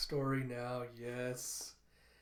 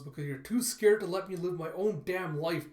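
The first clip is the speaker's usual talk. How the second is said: louder, higher argumentative daughter; heated retort; 4 semitones higher, 6 dB louder than usual. Retold or imitated. Grown daughter argues with protective father, heated. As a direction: fire back in a retold quarrel.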